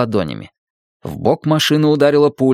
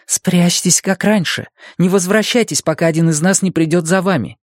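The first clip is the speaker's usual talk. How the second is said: raised pitch, pressing warning; tense order, not shouted; urgent instruction; 6 semitones higher than usual. A narrator's reading. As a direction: urge them to hide, pitched higher. Urgent order to hide during attack.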